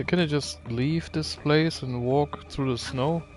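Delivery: ordinary talk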